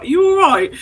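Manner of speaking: concerned stranger. Retold or imitated. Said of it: "she was like".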